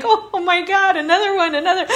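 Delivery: high, loud laughing exasperation; exasperated mock wail. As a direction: groan at yet another one. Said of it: "I'm like".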